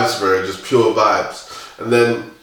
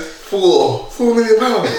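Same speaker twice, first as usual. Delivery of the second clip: retold disbelief; 9 semitones higher, slower than usual. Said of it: "He goes".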